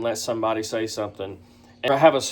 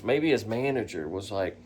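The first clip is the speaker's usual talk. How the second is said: ordinary talk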